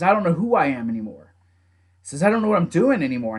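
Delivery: usual speaking voice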